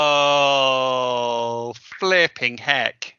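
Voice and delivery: slow, drawn-out amazed gasp; stunned by the prize; awed surprise. Acted. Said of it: "make noises of how it makes you feel".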